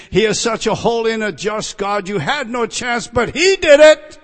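high, forceful pulpit delivery; impassioned preaching. Real, not acted. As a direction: preach hard, building to a shouted climax.

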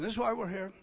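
ordinary talk

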